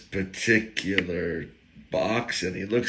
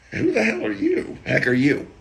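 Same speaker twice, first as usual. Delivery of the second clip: much higher-pitched mock demand; imagined incredulous challenge; 13 semitones higher, 3 dB louder than usual. Church elder sizing up the visitor, high-pitched mock challenge. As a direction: voice the big man's thought, pitched way up. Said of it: "I'm thinking he's thinking that".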